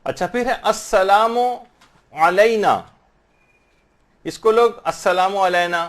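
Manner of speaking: ordinary talk, nothing marked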